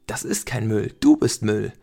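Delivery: lauter werdend bis zum Anschreien; wütend zurückschlagen; zornig. Acted. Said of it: "Felix wurde zornig". (zorniger Ausbruch, zum Schluss laut)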